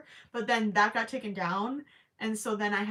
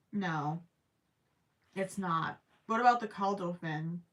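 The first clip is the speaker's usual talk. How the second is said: usual speaking voice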